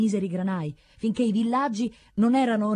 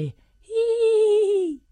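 long, high-pitched "eee"; thin held high squeal; wordless cry; 5 dB louder than usual. A narrator's reading. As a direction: sustain one piercingly high, loud vowel. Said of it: "la sua bocca disse".

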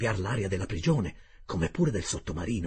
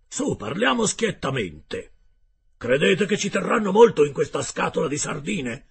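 gruff harpooner Ned; 7 semitones higher, 7 dB louder than usual. Acted. Ned Land's voice in dialogue.

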